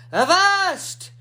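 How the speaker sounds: high, loud pirate cry; pirate shout. Acted. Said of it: "and shouted".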